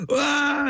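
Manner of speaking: high imitation of a child's cry; put-on high-pitched "waah"; mock bawling wail. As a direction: imitate a toddler bursting into a wail. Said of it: "He started bawling".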